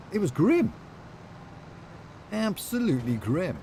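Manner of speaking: high, hammed-up Aussie twang, lightly done; a cheesy mock Australian voice; comedy Australian accent. Streamer jokes to chat in a cheesy Australian accent. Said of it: "You would be beaten for that accent".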